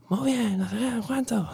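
mucho más agudo, imitando a un adolescente pasota; hijo adolescente desganado. Retold or imitated. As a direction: pon voz de crío que pasa de todo. (imitando al hijo adolescente con desgana)

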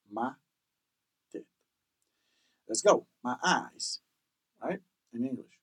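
ordinary talk, nothing marked

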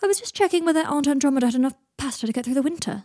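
high, loud, airy excuse; lightly put-on breezy character voice; Draco bluffing breezily.

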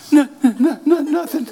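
high, loud, hammed-up weepy denial; comic tearful protest; mock tearful wife. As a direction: imitate a crying wife insisting all is fine. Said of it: "You're reading a letter and you're crying".

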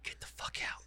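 retold order in a whisper; whispered repeat. Parent repeating the order to leave, whispered. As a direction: drop to an unvoiced, very soft whisper.

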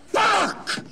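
sudden loud angry yell; furious shout. Acted. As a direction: explode in rage. Begbie's pool game goes wrong.

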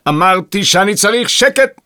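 high irate shout; exasperated bellow. A narrator's reading. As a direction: yell for silence. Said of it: "צעק".

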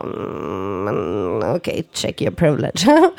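low, slow, sulky sigh of a martyr. Shown as a demonstration. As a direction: sigh it out, low and slow, feeling hard done by. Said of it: "när den martyrrösten kommer upp".

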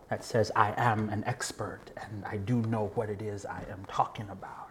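soft whispered worry; whispered thought. Real, not acted. Voicing the inner questions before going out, whispered. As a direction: let it fall to a whisper as you ask.

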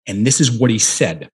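ordinary delivery

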